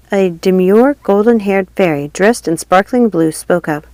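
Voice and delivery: ordinary delivery, nothing marked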